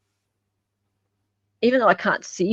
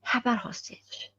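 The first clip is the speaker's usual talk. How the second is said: whispered aside; 3 semitones higher, 12 dB quieter than usual. Real, not acted. Host whispering the joke again to the producer. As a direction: repeat it in a breathless whisper. Repeats the joke under breath.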